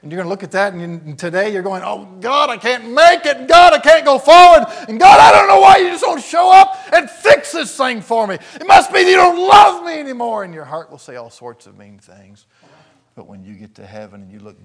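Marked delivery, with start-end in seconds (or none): 1.8-10.4 shouted, anguished pleading, much higher and louder; a desperate believer shouting his prayer at God; desperate cry to God. Acted. Anguished prayer voiced for listener.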